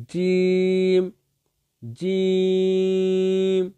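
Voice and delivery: জিম with mid-tongue on the palate, very drawn out; sustained teacher's drill of জিম; demonstrated letter sound. Shown as a demonstration. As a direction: stretch জিম on one level note. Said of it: "তার উপরস্থ তালুর সঙ্গে লাগিয়ে".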